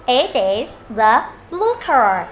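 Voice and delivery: word by word, drawn-out blue car; slow model reading. Shown as a demonstration. Teacher modelling a sentence, slow and careful. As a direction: read it out slowly for learners to copy.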